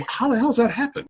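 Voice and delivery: high-pitched incredulous question; retold bafflement. Visitor retelling her shock at the dialect, high-pitched bafflement. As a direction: play up the baffled disbelief.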